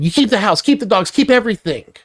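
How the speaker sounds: mocking the wife in a high put-on voice; high sneering mimic of her demands. Wife quoted hanging up angrily.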